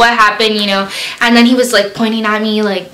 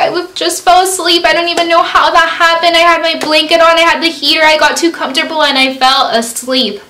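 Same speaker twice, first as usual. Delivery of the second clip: high, rushed tearful explaining; mock-crying ramble, easing at the end; retold crying; 6 semitones higher, faster than usual. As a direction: imitate blubbering out an excuse. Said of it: "I was all crying".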